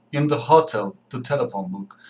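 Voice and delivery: ordinary delivery, nothing marked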